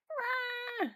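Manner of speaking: high frustrated yelp; frustrated cry. Real, not acted. Fed up with cleaning, a high yelp. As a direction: let out a sudden squeal of protest. Outburst after refusing to clean.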